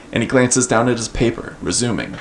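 ordinary talk

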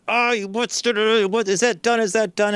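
high, fussy coworker voice; impression of Chris. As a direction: pitch up, frantic and fussy. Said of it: "Chris would be like".

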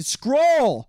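calls it out, high and loud. Real, not acted. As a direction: shout the word out. Calling out the scroll option.

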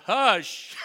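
sudden loud high hush to the storm; a sharp shouted command. Acted. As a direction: silence the wind with one booming word. Jesus commanding the wind.

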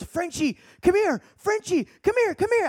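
high-pitched imitation of a girl calling; excited, squeaky mock summons; urgent calling over.

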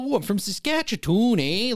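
high, exaggerated Canadian accent. Jacked movie dude greeting the wives, mock Canadian accent. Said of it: "then he starts talking".

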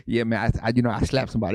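joking low gangster voice; Wade Bryant. Retold or imitated. Pastor friend casually owning up, comic gangster voice. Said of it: "That's how he sound".

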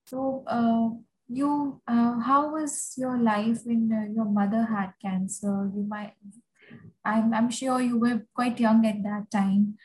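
ordinary talk

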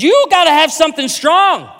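high, loud, hammed-up Irish brogue; tipsy Irish lady giving marriage advice; drunk Irish lady accent. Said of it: "She says".

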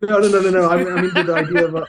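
laughing it off. Real, not acted. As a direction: protest through laughter. Laughing denial.

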